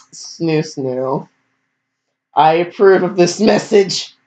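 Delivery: a hint of a political ad voice, lightly done; deadpan ad-style sign-off; mock approving relative. Relative at the wedding, mock approving the couple. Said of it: "I'd be like".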